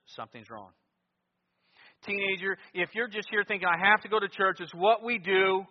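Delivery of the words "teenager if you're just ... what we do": loud, raised pulpit challenge; forceful, high-pitched exhortation; full-force preaching. Real, not acted.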